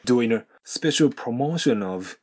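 "doing a" linked as "doin-a", said slowly; slow model with no G on doing; dropped G. Shown as a demonstration. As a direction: run the N of doing into "a". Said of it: "doingのGを飛ばしてNとA".

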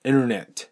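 "インターネット" said as innernet; American dropped T. Shown as a demonstration. Teacher shows native pronunciation, "インターネット" as innernet. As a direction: drop the first T of "インターネット", American style. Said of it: "ネイティブは".

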